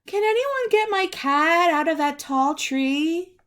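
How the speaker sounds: a worried neighbour calling up from below; high pleading voice. Acted. A lady above ground asking for help with her cat, called high. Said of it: "Rumpel imagining".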